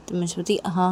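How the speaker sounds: ordinary talk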